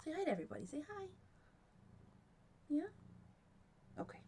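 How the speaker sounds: high, hushed pet talk; high hushed voice to a cat; soft cat coaxing. Real, not acted. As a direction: coax the cat softly to say hi. Coaxing cat to greet viewers.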